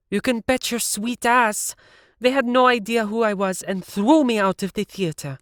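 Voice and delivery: furious at being thrown out; angry Siv. A narrator's reading. Siv angrily recounts the audition.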